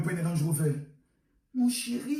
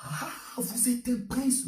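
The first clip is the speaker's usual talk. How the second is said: normal talking voice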